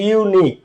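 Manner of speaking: ইউনিক opening with a clear 'yu' glide; yu sound demo. Shown as a demonstration. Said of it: "ইউ ইউ এর মতোই উচ্চারিত হচ্ছে".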